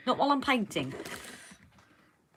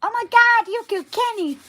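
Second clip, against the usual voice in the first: South Park quote; 12 semitones higher, 7 dB louder than usual. Retold or imitated. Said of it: "Kenny from South Park".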